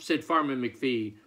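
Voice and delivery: plain reading voice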